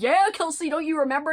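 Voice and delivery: teasing disbelief; incredulous friends. Retold or imitated. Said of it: "And then everybody was like".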